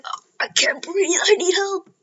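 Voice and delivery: breathless, strained whisper of someone suffocating; high, airless plea for help; choking for air. Retold or imitated. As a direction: gasp the words out like you're being smothered. Deku trapped by slime monster.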